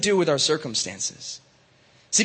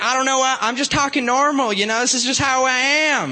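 higher-pitched mock Southern protest; Southern accent; 4 semitones higher than usual. Teen telling parents they talk normal, Southern accent. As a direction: insist in a put-on Southern accent. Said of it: "speak in a Southern accent".

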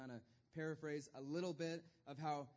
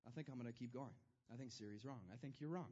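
much softer, lower stubborn mutter; stubborn dismissal; 5 semitones lower, 6 dB quieter, faster than usual. Retold or imitated. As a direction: brush off the advice quietly. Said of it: "And I'm like".

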